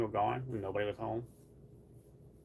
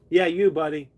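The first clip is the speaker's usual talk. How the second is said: louder, fond call to the cat; cooing at the pet; pet talk; 3 semitones higher, 9 dB louder than usual. Real, not acted. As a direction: tease the cat lovingly. Talking to her cat.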